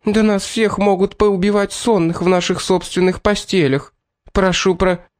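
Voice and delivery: high-pitched indignant protest; alarmed, indignant cook's complaint. A narrator's reading. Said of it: "с негодованием вопросила повариха".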